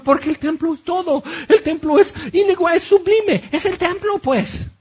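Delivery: high, indignant, pleading for the temple; scandalized temple leaders. Acted. Religious leaders protest against destroying the temple, high indignation. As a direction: play the outraged priests clutching their pride.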